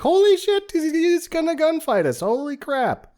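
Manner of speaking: very high, loud at first; mock panicked astonishment. Onlookers realizing he will gunfight, high mock panic. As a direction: squeal it in hammed-up alarm. Voices startled onlookers at the shot.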